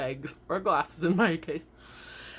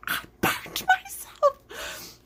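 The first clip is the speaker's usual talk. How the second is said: very high, slow, whispered crying; crying whimper; 4 dB louder, much slower than usual. Retold or imitated. Burned by soup, high slow whimpering cry. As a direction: whimper it through tears. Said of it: "And I was like".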